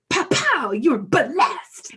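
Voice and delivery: loud, high, punched like two slaps; aggressive mock blessing. Mom mimicking the stranger's blessing, punchy and aggressive. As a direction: hit BLESSED like a blow. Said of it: "It felt like a slap twice in the face".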